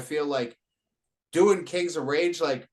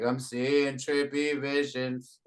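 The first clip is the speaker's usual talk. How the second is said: slow, listless self-imitation; unenthusiastic; 4 dB quieter, slower than usual. Rapper mocking their own failed remake, unenthusiastic. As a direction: recite it with no enthusiasm, dragging it out. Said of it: "you couldn't hear any enthusiasm in my voice".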